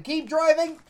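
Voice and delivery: shouted order. Acted. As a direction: yell at the driver to go on.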